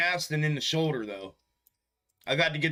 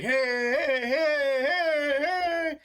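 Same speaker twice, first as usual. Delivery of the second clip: rising, much higher, crying out to a newcomer; a whole room shouting hello, climbing higher each time; excited crowd greeting; 12 semitones higher, 3 dB louder, much slower than usual. Retold or imitated. Chat members greeting a newcomer like a loud Italian family, excited high calls. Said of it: "It's like my loud Italian family".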